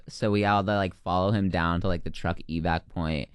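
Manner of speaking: normal talking voice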